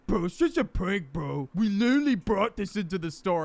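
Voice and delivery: put-on voice of a protesting prankster; mocking prankster voice. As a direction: imitate a prankster's indignant excuse. Imitating the pranksters' excuse.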